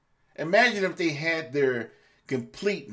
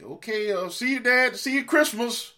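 light, higher and louder mock farewell; breezy goodbye; 9 semitones higher, 4 dB louder than usual. Child dumping Dad at the home, mock breezy goodbye. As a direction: toss off a cheerful see-you-later to Dad.

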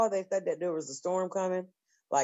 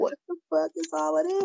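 shocked; 13 semitones higher, faster than usual. Retold or imitated. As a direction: react with stunned disbelief. Waking to unexpected snow.

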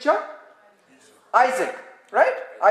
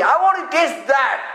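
usual speaking voice

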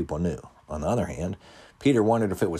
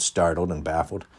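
usual speaking voice

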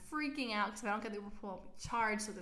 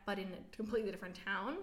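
normal talking voice

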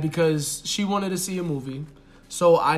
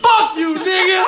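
high, loud mock blowup, laughing at the end; mock outburst; 13 semitones higher, 8 dB louder than usual. Friend acting out her outburst at the door, loud high mock yell. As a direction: switch to yelling the moment she's out. Mocking her turn once home.

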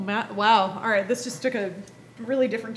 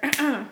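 sharp throat clear after choking; 5 semitones higher, 3 dB louder than usual. Real, not acted. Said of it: "choked on my water".

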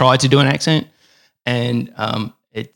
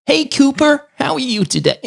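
higher-pitched attempt at American; California accent; 9 semitones higher than usual. Australian greets Cooper from California, attempted California accent. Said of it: "please do a California accent".

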